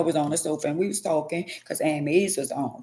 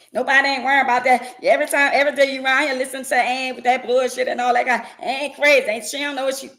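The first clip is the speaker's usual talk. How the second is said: higher, louder, fast rant; ranting partner; 6 semitones higher, 3 dB louder, faster than usual. Acted. Partner ranting about the radio host, fast irritated rant. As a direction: rattle off complaints, irritated.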